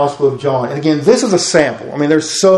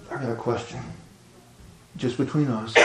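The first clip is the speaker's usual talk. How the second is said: hushed, much softer secret ask; quiet question between two men; secretive; 3 semitones lower, 15 dB quieter than usual. Retold or imitated. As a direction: keep it low so no one hears. Secret night visit, hiding from others.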